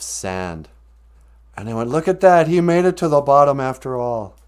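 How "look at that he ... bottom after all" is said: louder, higher, marvelling; joyful amazement. Acted. The brothers find sand in the little paw, joyful and loud. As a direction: announce the miracle with rising wonder.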